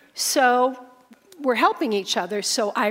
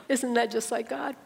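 ordinary delivery

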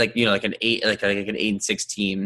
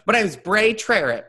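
much higher, laughing Texas cowboy voice; Texas accent; 11 semitones higher, 3 dB louder, slower than usual. Acted. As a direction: play a Texas cowboy alter ego. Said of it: "That sounds a little more Texas".

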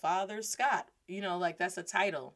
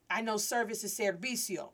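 ordinary delivery, nothing marked

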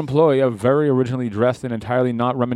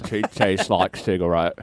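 Forrest Gump; 6 semitones lower, slower than usual. Retold or imitated. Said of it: "Forrest Gump".

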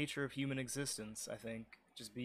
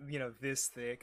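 ordinary talk, nothing marked

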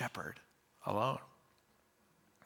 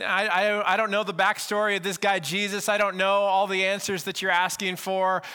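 earnest outburst; 6 semitones higher, 5 dB louder than usual. Retold or imitated. Healed man defends himself, voiced.

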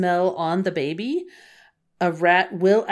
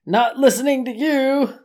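higher, louder stubborn voice; stubborn old dog; 7 semitones higher, 5 dB louder than usual. Retold or imitated. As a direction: brush him off, stubbornly. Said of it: "Trusty's like".

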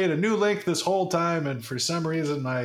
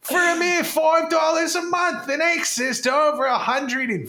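mock Australian accent; 7 semitones higher than usual. Ad spoof drifting into Australian accent. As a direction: sell it in a cartoon Australian voice. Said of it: "devolves into Australian".

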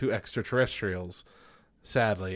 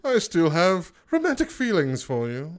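much higher, hammed-up posh gentleman through laughter; posh rich-man voice; 10 semitones higher, 3 dB louder than usual. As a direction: play a snooty rich suitor declaring love. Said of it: "rich part of the".

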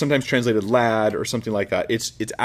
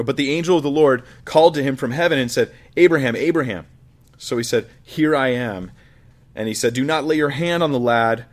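raised, solemn Bible reading; scripture reading; 2 semitones higher, 4 dB louder than usual. Acted. Preacher reading Genesis to the congregation, solemn. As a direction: read it as the drama builds.

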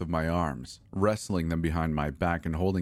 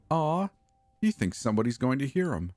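much higher, quicker mock baby-talk coo; doting; 7 semitones higher than usual. A narrator's reading. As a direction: coo at him like a silly pet. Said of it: "in a doting voice".

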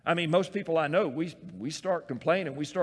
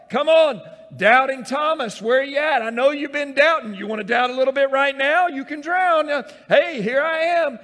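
loud, higher, playful taunting call across the water; teasing Jesus; 11 semitones higher, 12 dB louder than usual. Acted. Jesus teasing his disciples in the storm, loud playful call. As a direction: call out cheekily to the struggling fishermen.

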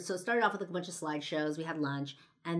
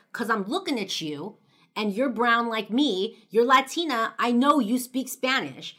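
loud, higher, insistent accusation; shooting back; 6 semitones higher, 10 dB louder than usual. Retold or imitated. Stranger insisting the brown girl must speak Spanish, loud and insistent. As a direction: hammer every word at her. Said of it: "he shoots back".

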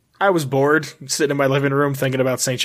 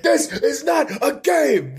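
[name] shout; 12 semitones higher, slower than usual. Retold or imitated. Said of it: "blasting [name] song lines".

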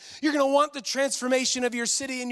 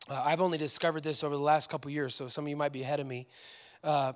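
hoarse, low, worn-out voice; a tired, scratchy near-whisper; raspy whisper; 7 semitones lower, 4 dB quieter than usual. Real, not acted. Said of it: "I'm losing my voice".

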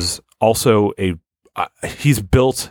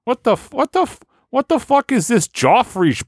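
high-pitched Boston complainer; Boston accent. Acted. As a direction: gripe in an exaggerated, squeaky-high Boston accent. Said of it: "that has that real accent".

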